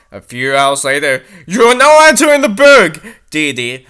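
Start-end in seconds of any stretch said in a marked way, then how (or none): 1.5-3.0 high, loud announcement; announcer voice. Acted. Welcome announcement at the burg entrance, high and loud. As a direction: boom it like a welcome sign.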